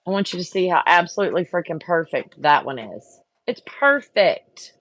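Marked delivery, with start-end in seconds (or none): 3.5-4.7 slow, drawn-out, higher and louder; exasperated irony. Real, not acted. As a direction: drag it out in disbelief. Exasperated at ruined cookie.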